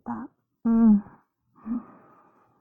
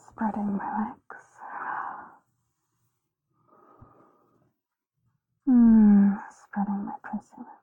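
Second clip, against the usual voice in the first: whispering. Real, not acted. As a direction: whisper it close and intimate. Intimate ASMR whisper.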